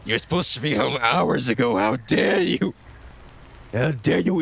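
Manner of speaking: high, loud hammed-up villain rage; over-the-top evil mom tirade; mock furious rant. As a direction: rant like a demon possessing the parent. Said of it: "Good lord".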